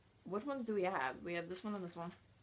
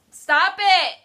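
sharp, higher and louder stop; loud yelp; 9 semitones higher, 15 dB louder than usual. Real, not acted. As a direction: yelp it at him. Reacting to the smell.

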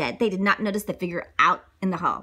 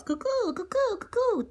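high cuckoo calls; cuckoo bird call; 13 semitones higher than usual. A narrator's reading. Principal demonstrating bird calls, high cuckoo. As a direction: imitate a cuckoo clock bird. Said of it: "demonstrated his favorite bird calls".

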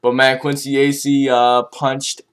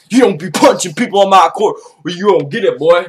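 mock Melo scolding; 5 semitones higher, faster than usual. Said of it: "That's what he basically said".

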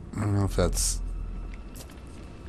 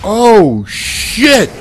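high, loud terrified shouts; yelling at the screen as a demon closes in; panicked yelling; 12 semitones higher, 5 dB louder than usual. Real, not acted. Player fleeing a monster, screaming in real panic.

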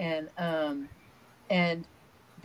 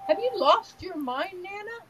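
high-pitched mock-incredulous child's question; imitating grandson; 10 semitones higher than usual. Six-year-old grandson questioning his Nana in the hot tub, imitated incredulity. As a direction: voice a little boy's disbelief, pitched well up. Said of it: "And he goes".

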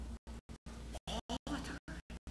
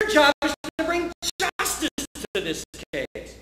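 loud, high, slow demand for justice; grieving parent shouting at the judge; outraged parent; 11 semitones higher, 11 dB louder, slower than usual. Retold or imitated. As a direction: demand justice at the top of your voice. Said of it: "You say".

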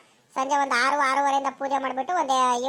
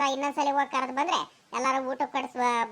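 ordinary talk

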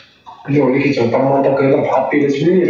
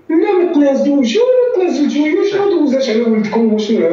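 mocking whiny relative; 10 semitones higher than usual. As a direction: drag it out like a nagging aunt on the phone. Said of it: "كيدير".

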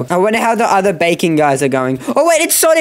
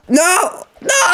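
panicked cry; 11 semitones higher than usual. Real, not acted. Falling in game, panic.

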